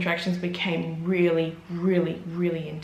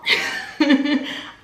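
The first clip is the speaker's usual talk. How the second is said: breathy laugh; 7 semitones higher, 4 dB louder than usual. Real, not acted. Laugh after surprise.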